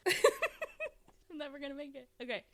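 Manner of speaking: laughing, can't hold it together. Real, not acted. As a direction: giggle through it. Cracking up before the headline.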